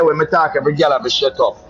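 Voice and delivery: ordinary talk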